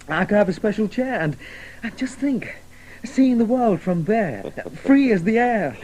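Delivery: carried away by the dream; rapturous dreaming. Acted. Alex dreaming of flying.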